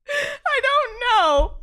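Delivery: amazed laughing, high and slow; mock-astonished giggle. Mock amazement after trick.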